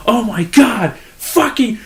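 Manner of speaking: high-pitched imitation of an outraged customer; mock flipping out. Irate customer at the drive-thru window, mock flipping out. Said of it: "she starts flipping out".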